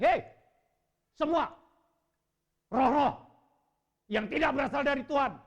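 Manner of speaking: loud, high command casting out spirits; commanding rebuke. Retold or imitated. Preacher rebuking spirits at his brother's bed, thundering command. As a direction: order the spirits out with full force. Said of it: "saya bilang".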